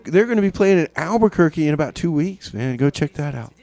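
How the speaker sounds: usual speaking voice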